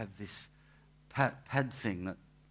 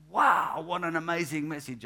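gobsmacked wow; 4 semitones higher, 7 dB louder than usual. Retold or imitated. Retelling being gobsmacked by sermon.

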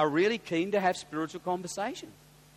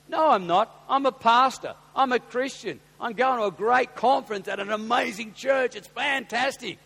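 louder, higher, proud declaration; bold and proud; 6 semitones higher, 7 dB louder than usual. Shown as a demonstration. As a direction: announce it proudly, no shame. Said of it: "We need to talk like that".

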